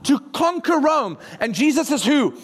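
usual speaking voice

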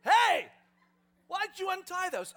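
loud, high, startling yell; challenging shout. Acted. Owner catching disciples untying his donkeys, challenging shout.

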